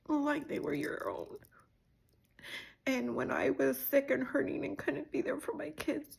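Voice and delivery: thanking her while trying not to cry. Real, not acted. Sister thanking sister for caring for her kids, tearful. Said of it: "without crying".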